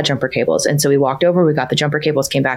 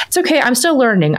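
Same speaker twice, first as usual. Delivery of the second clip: higher, lightly voiced young girl; a hint of a small child soothing herself; little daughter's voice; 8 semitones higher than usual. Daughter talking herself through a mistake, lightly voiced child. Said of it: "she'll just say to herself".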